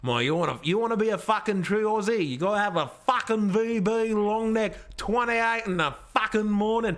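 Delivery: broad ocker Australian accent, lightly put on. Mocking a mate in a broad Australian accent. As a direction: play up the Australian accent, swearing. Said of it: "Australian voice actor".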